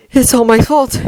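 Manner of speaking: high-pitched, frantic self-blame; frantic thought. A narrator's reading. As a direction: think it in a rising panic. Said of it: "he frantically thinks".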